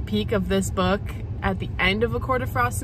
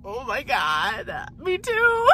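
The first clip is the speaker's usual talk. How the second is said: slow start leaping to a high squeal; retold gush ending in a squeal; excited; 11 semitones higher, slower than usual. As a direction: re-enact thrilled fan agreement, drawn out. Retold squeal with fellow fans.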